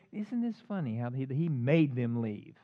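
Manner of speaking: usual speaking voice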